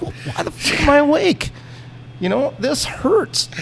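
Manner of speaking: high, pleading yell; retold outraged yelp at the surgeon; indignant cry. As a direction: protest in pain, half laughing. Retold protest during surgery.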